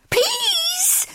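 piercingly high, long squeal; screamed. A narrator's reading. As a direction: shriek it like a startled kitten. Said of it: "screamed El Scipito Mamito".

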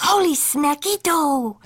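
put-on high excited kitty boy; exclaiming. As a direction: cry out with glee. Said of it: "exclaimed the kitty boy".

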